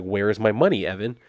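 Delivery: ordinary talk, nothing marked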